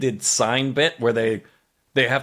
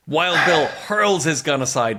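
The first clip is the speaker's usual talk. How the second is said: usual speaking voice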